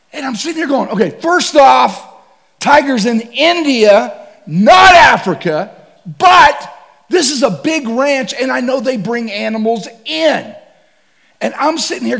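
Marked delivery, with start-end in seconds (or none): 0.9-10.5 higher, louder, slow frantic reasoning; panicked rant. Retold or imitated. Hunter panicking about tigers, loud frantic rant.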